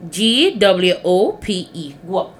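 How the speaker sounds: "G W O P E" spelled slowly, then "Guap" as one syllable. Shown as a demonstration. Teacher spelling the patois word, slow and clear. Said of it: "It's one word".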